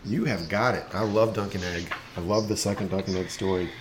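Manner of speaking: ordinary delivery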